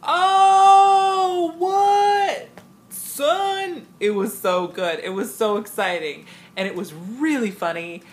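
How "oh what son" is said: high, loud drawn-out jeer; mock-scandalized shout; crowd reacting to burn. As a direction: imitate an audience howling at an insult. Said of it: "somebody got a sick burn".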